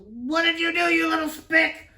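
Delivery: very high, loud mock shriek; teacher's outburst. Teacher grabbing the boy who threw a punch, imitated shriek. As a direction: screech it as the outraged teacher. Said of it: "she goes".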